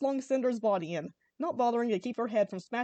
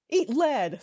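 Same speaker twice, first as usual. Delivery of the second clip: louder, higher battle cry; screamed; 4 semitones higher, 6 dB louder than usual. A narrator's reading. Spyro firing his minigun at the villains, screaming. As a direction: roar it as you open fire. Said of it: "Spyro screamed".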